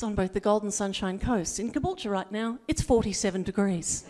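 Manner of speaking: lightly voiced on-air weather report; mock radio weather read. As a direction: read the temperatures like a deadpan broadcast. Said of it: "I said".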